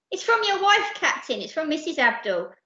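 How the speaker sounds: high start, excited announcement; crying out. Acted. Percy showing the captain the note, excited cry. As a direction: call out the news excitedly. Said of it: "cried Percy".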